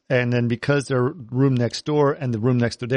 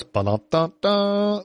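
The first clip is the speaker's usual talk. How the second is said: slow mock drumroll; 8 semitones higher, much slower than usual.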